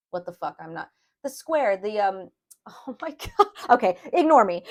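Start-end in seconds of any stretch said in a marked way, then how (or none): 2.7-4.7 laughing at herself, higher and louder; embarrassed laughter. Real, not acted. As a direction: giggle through it, caught blanking on a word. Laughs at forgetting the word.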